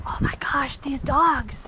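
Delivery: whispering oh my gosh at the dogs; awed whisper. Real, not acted. Rider whispering in awe at passing dogs. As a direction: hush it out in awe.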